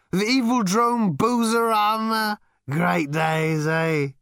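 high, slow, loud tipsy voice; slurry drunken bonhomie; drunk Ford. A narrator's reading.

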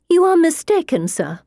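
a prim lady bristling at him; indignant lady. Acted. Lady character voiced higher.

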